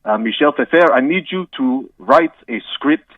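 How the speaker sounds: hammed-up French accent, halting with uhs. Guest apologises to the host in a mock French accent. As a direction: play a comic Frenchman stumbling through English.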